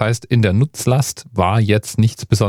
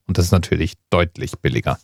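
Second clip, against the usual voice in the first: ordinary talk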